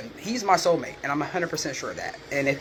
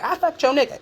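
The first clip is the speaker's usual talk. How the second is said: mocking a rival; 10 semitones higher, 4 dB louder than usual. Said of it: "be like".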